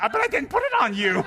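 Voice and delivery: high, loud confused retort; mock puzzled reply. Rinpoche answers a devotee who thanked him, high and baffled. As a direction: play it baffled and amused.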